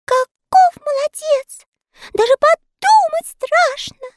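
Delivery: tiny elves gasping in wonder; amazed elves. A narrator's reading. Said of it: "вырвалось у всех эльфов". (восхищённые эльфы, очень высоко и громко)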